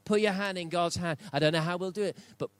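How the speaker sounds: high, loud, driving preacher voice; full-force preaching. Acted. Pastor exhorting the church at full volume. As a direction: preach it out hard to the room.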